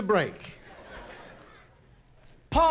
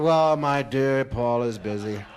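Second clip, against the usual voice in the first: smooth ladies' man; 9 semitones lower, 4 dB louder, slower than usual. Retold or imitated. Suave reply to the girls.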